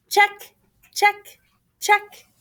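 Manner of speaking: chirpy checklist tick; cheerful high check; bright high check. A narrator's reading. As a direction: pop it up high like a tick mark. Ticking off list.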